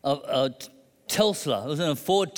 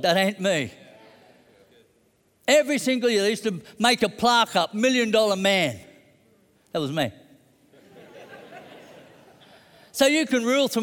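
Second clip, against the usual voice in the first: preaching at full force; 4 semitones higher than usual. Acted. Preacher proclaiming God's faithfulness to the congregation, full-force preaching. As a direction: preach it out with rising conviction.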